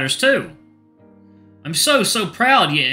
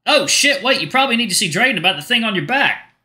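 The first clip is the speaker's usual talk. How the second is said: higher and louder burst; sudden realization; 10 semitones higher, 6 dB louder than usual. Acted. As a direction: blurt it as it dawns on you. Bucky suddenly remembers.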